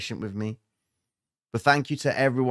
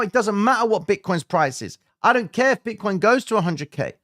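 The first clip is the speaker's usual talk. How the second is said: fired-up rant; 11 semitones higher, 5 dB louder than usual. Real, not acted. Fired-up rant about Bitcoin.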